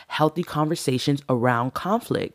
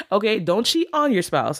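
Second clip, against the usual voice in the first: a quick joking "whoa, not that" warning; mock alarmed correction; 6 semitones higher than usual. Shown as a demonstration. Talking to viewers after a punning rule name, mock-alarmed. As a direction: pitch jumps up high in playful alarm. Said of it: "I didn't say cheat on your spouse".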